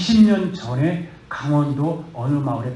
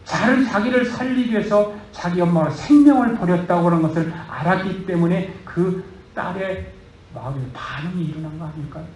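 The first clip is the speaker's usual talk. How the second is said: usual speaking voice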